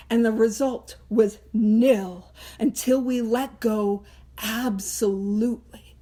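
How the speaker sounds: very slow, weighty delivery; emphatic slow reading. Shown as a demonstration. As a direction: spell out each phrase with gravity. Emphatic reading of the book text.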